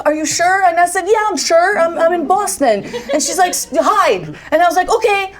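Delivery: reliving a panicky exchange at high pitch; retold frantic call. Retelling frantic phone call with mother.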